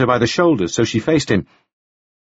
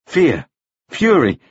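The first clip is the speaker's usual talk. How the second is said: ordinary delivery, nothing marked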